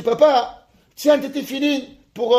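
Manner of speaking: put-on child calling up to his dad. Handing father the tefillin.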